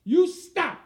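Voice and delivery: loud, high commanding yell; furious command to stop; angry shout. Retold or imitated. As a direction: bark it at fleeing boys. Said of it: "and I said".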